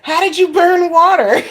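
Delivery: mock her through rising laughter; son laughing. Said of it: "he said".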